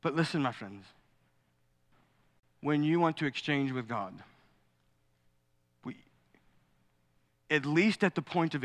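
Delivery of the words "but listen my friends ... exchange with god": ordinary talk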